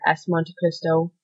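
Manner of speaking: ordinary delivery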